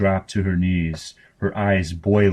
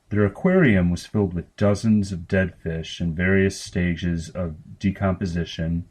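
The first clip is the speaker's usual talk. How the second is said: usual speaking voice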